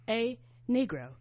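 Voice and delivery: ordinary talk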